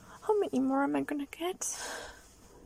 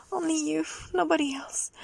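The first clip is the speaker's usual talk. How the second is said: normal talking voice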